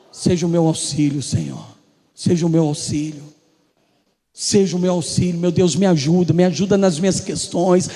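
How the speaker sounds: low, pleading prayer voice. Acted. Believer praying to God for help, pleading.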